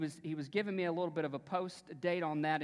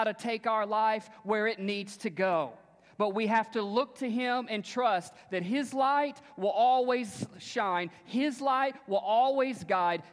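preaching; 5 semitones higher, 6 dB louder than usual. Acted. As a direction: build the sermon to a big climax. Preaching at full force.

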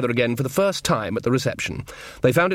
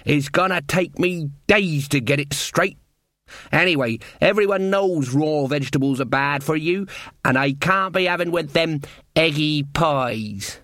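indignant cook; 3 semitones higher than usual. Acted. Cook complaining about new orders in her kitchen, indignant. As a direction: grumble like a put-out kitchen servant.

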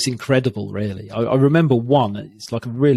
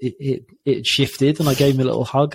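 ordinary delivery

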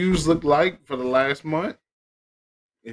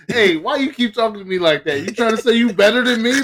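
mock offended parent; 7 semitones higher, 5 dB louder than usual. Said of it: "they be like".

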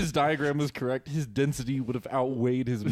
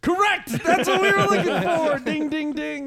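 loud, high, excited quiz host; game show host; 13 semitones higher, 7 dB louder than usual. Pretend. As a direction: announce the winner loudly, ringing the bell. Game-show host announcing a win.

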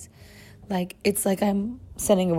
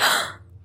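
mock gasp. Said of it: "And I'm like".

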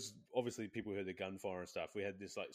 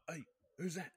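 mock call-out; 9 semitones higher than usual. Retelling in-game voice chat banter.

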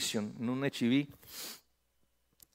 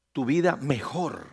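ordinary talk

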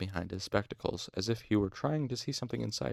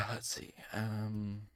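ordinary delivery, nothing marked

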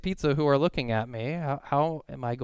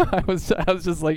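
laughing; 6 semitones higher, 8 dB louder than usual. Real, not acted. Laughing while retelling.

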